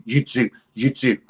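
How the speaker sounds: insistent mock gangster patter; lightly acted punter pestering the bouncer; pretend gangster. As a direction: keep badgering to get in. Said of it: "plazzy gangsters".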